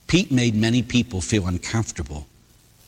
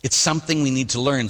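ordinary talk, nothing marked

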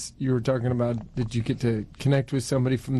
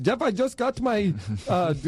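high-pitched, slow mock foreign accent; comic foreign-accented Uber driver; imitated foreign accent; 8 semitones higher than usual. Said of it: "I'm trying to do an accent".

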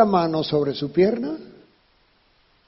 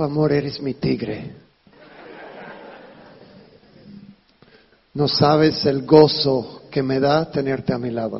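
tender wife; 6 semitones lower, 4 dB quieter than usual. Retold or imitated. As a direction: murmur it like a loving wife. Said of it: "me dijo". (esposa tierna, voz suave)

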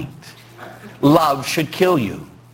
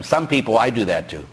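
ordinary talk

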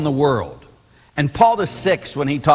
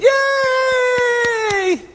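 very high, drawn-out cheering squeal; long high-pitched "yay" imitating a crowd; mock crowd cheer. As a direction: cheer like a whole excited audience. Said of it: "all the nuns went".

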